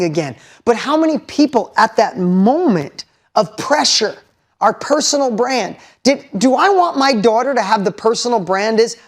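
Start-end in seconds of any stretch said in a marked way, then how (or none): none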